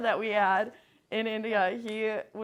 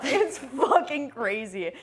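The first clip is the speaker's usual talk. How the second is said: laughing; 3 semitones higher, slower than usual. Real, not acted. Laughing about India traffic.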